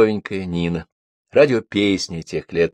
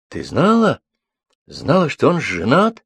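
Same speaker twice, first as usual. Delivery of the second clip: appalled disbelief; 6 semitones higher than usual. A narrator's reading. Shocked man questions Lyolya about affair.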